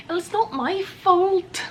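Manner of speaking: high, slow, badly faked Scottish; hammed-up Scottish protest; bad Scottish accent. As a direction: attempt a Scottish wail, drawn out. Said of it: "I can't do a Scottish accent".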